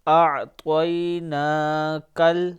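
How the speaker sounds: اعطیناکل slowly, ending کل with zabar; teacher's slow recitation drill; slow syllable drill. Shown as a demonstration. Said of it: "کاف زبر لام".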